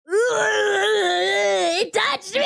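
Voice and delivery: high, slow mock-anguished cry; disgusted wail. Retelling spider touching her.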